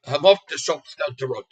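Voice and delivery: a light Irish accent, lightly put on. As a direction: say it like a friendly Irish local, lightly. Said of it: "with a little Irish, Irish accent".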